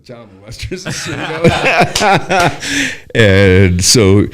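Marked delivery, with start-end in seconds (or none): 1.2-4.3 talking loudly through a big laugh; helpless laughter in the words. Real, not acted. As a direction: burst out laughing mid-story, high and loud. Laughing at his own story.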